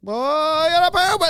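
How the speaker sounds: put-on high whiny kid voice, very high; little kid voice. Dad imitating his toddler son's urgent need. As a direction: squeak it like a desperate toddler.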